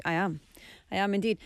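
ordinary talk

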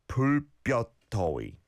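"불볕더위" spread out syllable by syllable; careful model pronunciation. Shown as a demonstration. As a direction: say "불볕더위" carefully for learners to follow. Teacher models phrase slowly, then quicker.

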